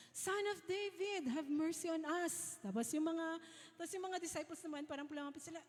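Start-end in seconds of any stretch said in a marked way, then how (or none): 0.1-2.6 high-pitched mock shout at no extra volume; imitated cry out. Two blind men call to passing Jesus, imitated cry. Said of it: "Tapos sumigaw sila".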